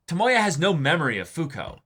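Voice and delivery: ordinary talk, nothing marked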